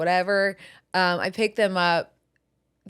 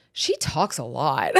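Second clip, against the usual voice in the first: mock grumpy dad; 6 semitones lower, slower than usual. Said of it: "he was like".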